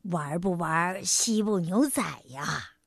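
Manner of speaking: low, soft, slow tiger offer; big tiger voice. A narrator's reading. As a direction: coax gently in a deep voice. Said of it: "大老虎说". (低沉温和的大老虎腔)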